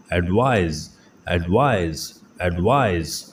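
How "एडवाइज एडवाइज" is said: ordinary talk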